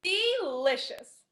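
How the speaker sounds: ordinary talk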